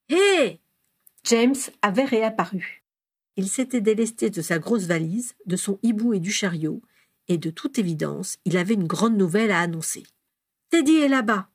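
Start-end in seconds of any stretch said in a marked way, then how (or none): 0.1-0.6 high, loud hail; calling out. A narrator's reading. James turning up with big news, calling out. Boy calls out to his family. 10.7-11.5 higher, louder, excited announcement; breathless. A narrator's reading. James reporting to his family, breathless. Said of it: "dit-il tout essoufflé".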